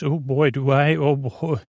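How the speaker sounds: usual speaking voice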